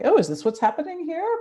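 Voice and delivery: high-pitched surprised Lodovico; a big hammed-up jump of surprise; mock surprise. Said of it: "more surprise".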